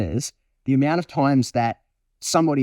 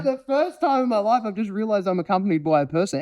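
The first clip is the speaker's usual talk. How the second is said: amazed dog; 6 semitones higher than usual. Acted. Dog realizing a person accompanies it, high amazed voice easing down. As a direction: voice a dog's dawning discovery, starting high. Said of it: "And the dog goes".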